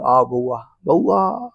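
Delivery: put-on soft, low coaxing of baby; tender father cooing; lovingly. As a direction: coax the child gently. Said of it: "भले वो प्यार से समझाते".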